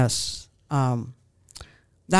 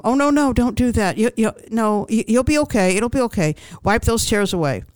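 higher, hurried mock reassurance; fussy comforting; 5 semitones higher than usual. Relative to someone crying, anxiously hushing them. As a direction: imitate someone fussing to stop another's tears.

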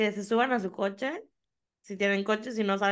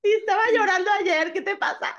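excited high; 10 semitones higher, 6 dB louder than usual. Real, not acted. Joking about crying yesterday.

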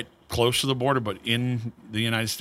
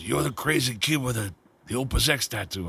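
put-on heavy scratchy voice of an old cigar maker; scratchy old man voice; 2 semitones higher than usual. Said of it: "that real heavy, scratchy voice".

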